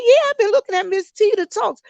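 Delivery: high-pitched retelling of sister's words; imitating sister. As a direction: play the sister, pitched well up. Quoting her older sister.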